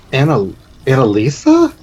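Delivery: halting, disbelieving question; astonished uncle spotting a lost child; stunned recognition. Acted. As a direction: falter on the name, then ask it. Uncle sees lost niece unexpectedly.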